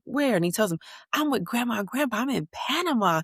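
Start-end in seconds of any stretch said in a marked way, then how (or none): 0.0-0.3 put-on high, quick little-boy question; curious kid. Son asking his cousin on FaceTime, high curious kid voice. 1.1-3.2 put-on higher, quick boastful kid voice; proud kid. Nephew bragging to his cousin, higher quick kid voice.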